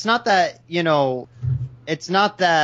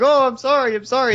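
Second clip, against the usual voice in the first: high, louder, flustered sorry; mock flustered apology; 10 semitones higher, 4 dB louder than usual. Imitating Anthro apologising to Golden Fox, high and flustered. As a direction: gush the apology up high and fast. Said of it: "And then you're like".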